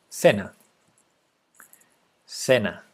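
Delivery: "Sena" said Spanish-style, SEH-na; Spanish pronunciation. Shown as a demonstration. Streamer trying a viewer's name, Spanish pronunciation. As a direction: read "Sena" with a clean S and open e. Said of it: "try to say it with Spanish spelling".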